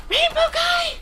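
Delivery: thrilled cry at spotting the rainbow guy; excited squeal. Real, not acted. Spots a rainbow subscriber.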